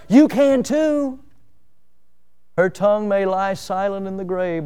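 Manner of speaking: ordinary talk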